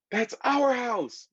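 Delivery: high, slow cry of alarm; shocked yell. A narrator's reading. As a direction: call out, seeing your home on TV. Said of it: "Martine yelled".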